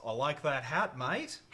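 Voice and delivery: broad Australian accent, loud and bright; Aussie accent, hit the mate. Shown as a demonstration. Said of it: "That's how I get into my Aussie accent".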